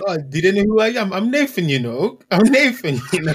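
loud, high, indignant mock boasting; angry ego. Acting out a bruised ego over an email, loud mock indignation. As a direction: puff up with outraged self-importance, half laughing. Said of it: "I could take an angry way, like, my ego could come up".

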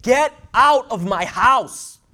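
high, loud, slow tearful cry; mother throws her son out, crying loud. Retold or imitated. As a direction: wail it like a heartbroken mother. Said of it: "She started crying".

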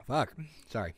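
ordinary talk

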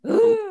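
loud, high-pitched ugh; exasperated groan. Real, not acted. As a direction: groan loudly at the request. Reacts to being asked for story.

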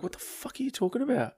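high, softened retold bafflement; puzzled mock-incredulous question; confused. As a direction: ask it in bewildered disbelief, under your voice. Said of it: "he saw I was confused".

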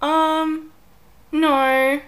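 hesitate, then shut it down; blunt refusal. Retold or imitated. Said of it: "I was like".

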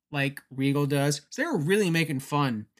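usual speaking voice

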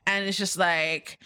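ordinary talk, nothing marked

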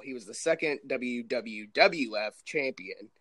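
ordinary talk, nothing marked

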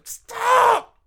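sudden high, loud yelled stop; shrieked mock plea to stop hearing it; mock scream. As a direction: shriek it like a panicked kid. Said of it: "screaming in my head".

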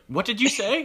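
high, sharp demand; mock angry sub. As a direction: imitate a sub confronting a student. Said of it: "She was like".

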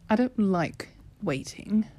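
whispered under her breath; a bored whispered complaint. Acted. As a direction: breathe it like a private grumble. Bored girl grumbling to herself.

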